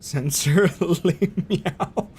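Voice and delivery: slow, giggling through the words; laughing. Real, not acted. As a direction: laugh while reading it out. Laughing at pun.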